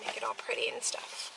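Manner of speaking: whispered under the breath; soft whisper. Real, not acted. Leaving to make the basket, soft whisper. Trailing off while heading out.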